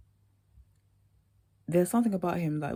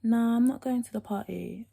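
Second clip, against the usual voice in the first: put-on American accent; 7 semitones higher, 4 dB louder than usual. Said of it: "got proper American voice".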